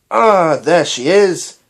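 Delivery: loud, slow, delighted greeting; hearty Doctor voice; enthusiastic. Acted. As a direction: beam at her waking up. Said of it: "said the Doctor enthusiastically".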